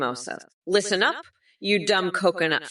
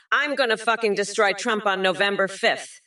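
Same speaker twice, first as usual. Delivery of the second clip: normal talking voice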